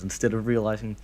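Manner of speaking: ordinary talk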